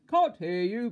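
very loud, high shopkeeper shout; booming holler; thundering shout. A narrator's reading. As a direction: thunder it at the customer. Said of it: "boomed the butcher".

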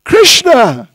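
loud, high call of the name. Retold or imitated. Auntie trying the name again on the baby, loud high call. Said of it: "So again she tried".

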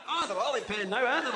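ordinary talk

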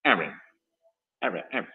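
aren't said low and clipped, repeated; aren't modelled quietly; drilled aren't. Shown as a demonstration. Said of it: "entonces, aren't".

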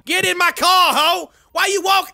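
a put-on Kanye voice, brash and cocky; [name] impression. Trash-talking strangers in game chat, brash Kanye voice. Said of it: "You guys wanna hear my real voice?".

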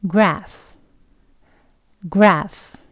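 "graph" ending in a clear F, ph said as F; teacher showing "graph" ends in F; F sound demo. Shown as a demonstration. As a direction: model "graph" with a final F for learners. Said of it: "두 번 해볼게요".

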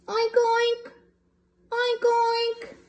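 high oinking piggy; high piggy oinks. Acted. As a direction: oink like a little pig. Pigs oinking.

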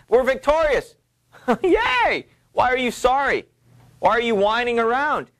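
high, triumphant and scornful; gleeful Lady Macbeth. Acted. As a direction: crow over the victory and mock his whining. Lady Macbeth mocks Macbeth's sorrow.

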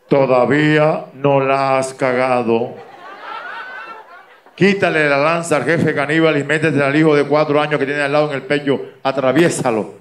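loud, solemn voice from above; voice from heaven. Acted. God answers the cornered man, booming heavenly voice. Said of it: "Salió una voz del cielo".

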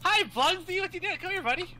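high, sugary fake friendliness; fake sweet greeting. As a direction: coo at him like a pet.

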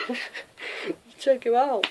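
gleeful cheer. Real, not acted. Cheering a teammate's kill.

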